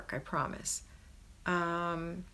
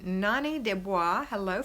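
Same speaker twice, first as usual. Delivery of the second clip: ordinary delivery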